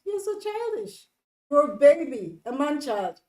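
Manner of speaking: exasperated telling-off; scolding. Acted. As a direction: chide like a fed-up wife. Scolding the sulking husband.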